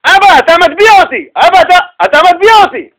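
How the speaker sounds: put-on a drowning girl shrieking for her father; terrified scream. Drowning daughter screaming at her father, panicked shriek. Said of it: "היא צורחת לאבא".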